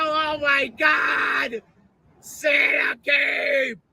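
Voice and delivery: slow, high shouted joy; drawn-out high shout of joy; excited kid shout. Retold or imitated. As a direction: yell it like a kid on Christmas morning. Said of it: "and go".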